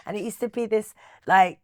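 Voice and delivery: ordinary delivery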